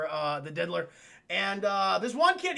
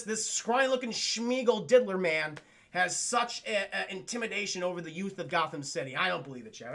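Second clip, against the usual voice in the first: normal talking voice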